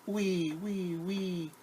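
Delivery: high thin buzzing wee; mosquito whine. Acted. Teacher imitating a mosquito for the children. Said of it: "A small mosquito".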